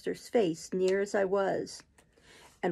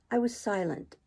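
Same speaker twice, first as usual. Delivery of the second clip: ordinary delivery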